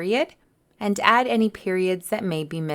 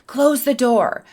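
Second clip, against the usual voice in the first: higher, louder command with urgency; demonstrated shout; 5 semitones higher, 5 dB louder than usual. Shown as a demonstration. Teacher showing students how an exclamation mark sounds, shouted. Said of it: "I am shouting it or yelling it".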